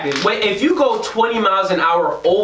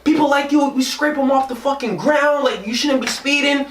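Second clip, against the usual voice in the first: angry cop; 7 semitones higher than usual. Retold or imitated. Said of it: "He was like".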